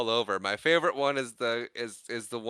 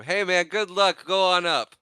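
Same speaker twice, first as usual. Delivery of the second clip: cheery send-off; 8 semitones higher, 6 dB louder than usual. Retold or imitated. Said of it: "the one worker's like".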